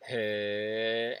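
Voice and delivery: drawn-out "Hey" with a gentle upward slope; rising intonation. Shown as a demonstration. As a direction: hold "Hey" long, lifting it slightly. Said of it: "the intonation can go up".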